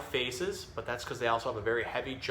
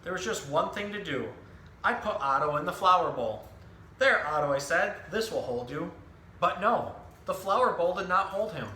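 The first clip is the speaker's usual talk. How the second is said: lively picture-book storytelling voice, each line clearly paced; reading to children; 3 semitones higher than usual. Acted. Zookeeper reading a book to children, animated storytelling. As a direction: tell the story to a room of young kids.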